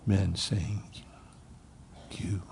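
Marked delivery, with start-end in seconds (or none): 2.1-2.4 hushed whisper. Pretend. Jesus whispering to his men.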